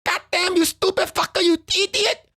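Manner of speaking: high, exasperated insult; shrill mock outrage at the haters; outraged retort. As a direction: squeal it out in disbelief. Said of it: "I'm like".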